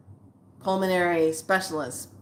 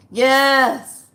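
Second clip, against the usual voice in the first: normal talking voice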